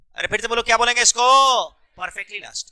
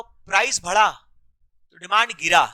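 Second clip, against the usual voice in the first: slow, spaced out; 3 semitones lower, slower than usual. Shown as a demonstration. Teacher recaps for the class, slow and spaced out. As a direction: pause after "price बढ़ा", then "demand गिरा". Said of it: "इतना धीरे बोल रहे हो".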